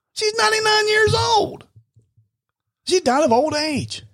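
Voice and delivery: high, drawn-out comic exclamation; exasperated retort. Acted. Answering people who ask how grandmother died, high exasperation. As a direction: stretch it out high, stating the obvious.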